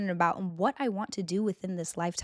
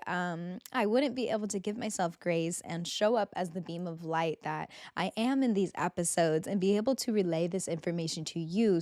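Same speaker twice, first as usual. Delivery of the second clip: ordinary talk, nothing marked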